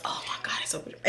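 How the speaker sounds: hushed, breathy-free whisper of awe; soft whisper over something beautiful; awed whisper. Real, not acted. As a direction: whisper it like it's sacred. Gushing over home birth videos.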